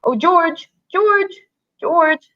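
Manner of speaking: desperate call for George; crying out. Acted. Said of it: "And he cried".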